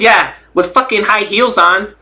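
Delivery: high, slow snapped retort; Rolly. Retold or imitated. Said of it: "the way he snapped it".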